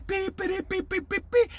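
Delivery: imitated piping. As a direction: mimic a little pipe with quick peeps. Imitates the Pied Piper's pipe.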